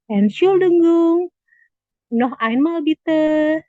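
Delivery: slow classroom model, projected; slow, loud model phrase. Shown as a demonstration. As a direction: sound out each syllable, loud and high. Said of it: "kita biasanya nanyanya".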